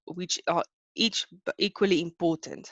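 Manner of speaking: usual speaking voice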